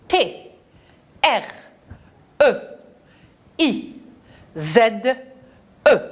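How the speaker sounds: one short letter at a time; T, R, E, I, Z, E with clear gaps; spelling aloud. Shown as a demonstration. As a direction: lead children letter by letter. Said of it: "On épelle le mot treize".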